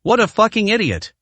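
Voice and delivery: high furious outburst. A narrator's reading. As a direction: spit it out in fury. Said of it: "Jin Quan was furious".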